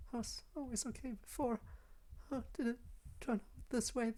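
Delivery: put-on high, soft, timid girl's voice; small, high, halting and hushed; frightened Yuri. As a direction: plead softly in a high, fragile voice.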